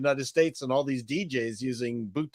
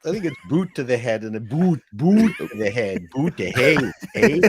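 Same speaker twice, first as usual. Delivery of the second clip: hammed-up Ottawa Canadian accent; lively Canadian lilt with a tagged-on eh; 3 semitones higher, 4 dB louder than usual. Shown as a demonstration. Canadian comic showing off his dialect, thick Ottawa accent. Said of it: "dialect or this thing I'm doing right now".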